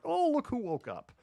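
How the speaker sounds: very high sing-out greeting; cooing. Real, not acted. Host spotting someone who just woke up, cooing. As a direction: pitch way up for the sleepyhead. Notices someone waking, breaks off.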